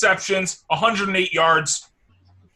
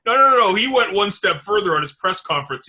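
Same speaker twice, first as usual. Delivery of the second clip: normal talking voice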